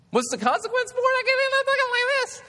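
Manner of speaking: very high-pitched squeaky voice, loud at the end; comic squeal. Acted. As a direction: squeal it at the very top of your range. Squeaky voice shows steroid consequence.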